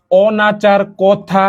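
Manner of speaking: অনাচার কথা drawn out very slowly, open অ; slow model pronunciation. Shown as a demonstration. Teacher slowly models অনাচার কথা for the class. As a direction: stretch out অনাচার কথা, mouth wide on অ. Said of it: "এই তিনটা একটু তোমরা উচ্চারণ করো".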